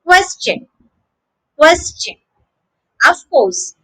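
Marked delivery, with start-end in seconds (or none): 0.0-0.7 "question" in full, KWES-chen; model pronunciation. Shown as a demonstration. Teacher presenting the whole word, clearly modelled. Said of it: "So the word is". 1.6-2.1 "question" in full, KWES-chen; model pronunciation. Shown as a demonstration. Teacher presenting the whole word, clearly modelled. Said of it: "So the word is".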